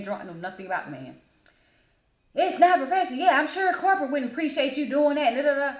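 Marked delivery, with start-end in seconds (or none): 2.4-5.7 high, loud mock complaint; hammed-up customer tirade; imitated yelling rant. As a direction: mimic an indignant ranting customer. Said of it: "he's just yelling".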